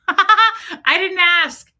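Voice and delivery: slow, high, loud laugh through the words. Real, not acted. Retelling the nurse's YouTube guess, laughing hard. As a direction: laugh it out, can barely get the words out.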